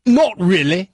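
a hint of a dry British "Not really"; deadpan British brush-off; British accent. British man answering the wife, dry British deadpan. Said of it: "here's exactly what he said".

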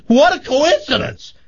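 high-pitched mock-delighted exclamation; acted excited amazement. Woman at a bar exclaims at farmer's news, hammed-up amazement. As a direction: shoot way up high with wonder.

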